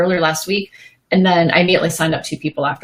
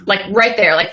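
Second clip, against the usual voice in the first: usual speaking voice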